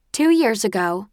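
lightly voiced instructor, a touch brisk; instructor addressing initiates. A narrator's reading. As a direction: address a group of trainees.

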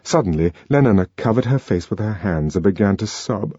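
ordinary delivery

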